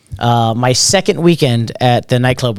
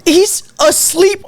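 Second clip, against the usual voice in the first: high, slow worried voice; scared protest; much slower than usual. Retold or imitated. Young host worried about the passed-out star, high and slow. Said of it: "I'm terrified".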